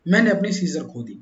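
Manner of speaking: normal talking voice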